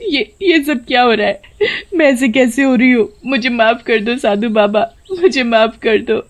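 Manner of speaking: high tearful pleading; crying plea. A narrator's reading. Rupa begs the sadhu for mercy, crying. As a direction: beg through tears. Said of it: "रोते हुए बोलती है".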